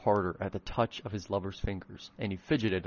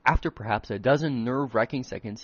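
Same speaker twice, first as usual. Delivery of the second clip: usual speaking voice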